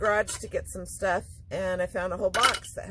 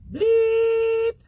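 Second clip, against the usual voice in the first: bleep sound; 10 dB louder than usual. Pretend. Censor beep sound effect.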